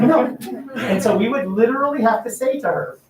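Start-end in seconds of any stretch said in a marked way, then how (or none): 0.0-0.4 laughing no. Retold or imitated. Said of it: "I'm like".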